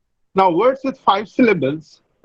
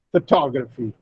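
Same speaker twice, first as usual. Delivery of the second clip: "Photography" said pho-TOG-raphy, softer; stress on second syllable; 5 semitones lower, 4 dB quieter than usual. Shown as a demonstration. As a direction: lean on TOG in "Photography", lower and quieter. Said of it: "the second syllable receives the stress".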